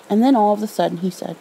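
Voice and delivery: ordinary delivery, nothing marked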